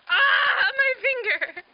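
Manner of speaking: real cry of pain, high and loud, slowing on the ah; pained shout. Real, not acted. Hurts her finger on camera, pained shout.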